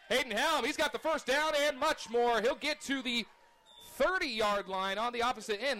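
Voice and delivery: rising excited play-by-play; excited call. Real, not acted. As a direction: call the long run with growing excitement. Big run, play-by-play excitement.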